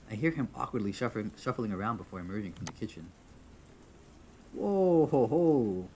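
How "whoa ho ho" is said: loud, high whoa falling into ho-ho; amazed reaction. Real, not acted. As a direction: exclaim at a startling reveal. Reacts to the rabbit's appearance.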